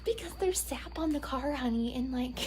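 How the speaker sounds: high, sweet motherly voice; imitating mom. Imitating mom explaining the car wash to her daughter, high and sweet. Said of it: "she's like".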